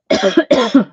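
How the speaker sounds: sudden harsh cough; coughing on dust. Real, not acted. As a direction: cough from breathing in sawdust. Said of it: "I think I just breathed in some sawdust".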